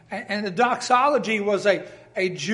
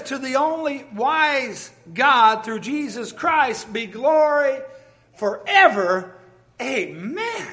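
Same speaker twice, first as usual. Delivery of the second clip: exultant reading; 4 semitones higher, 4 dB louder, slower than usual. Acted. Reading doxology as outburst.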